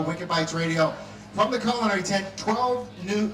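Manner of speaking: normal talking voice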